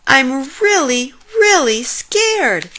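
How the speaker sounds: high, loud, slow frightened wail; frightened cry. A narrator's reading. Jim overwhelmed by the dark, frightened cry. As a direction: plead in fear. Jim continues his scream.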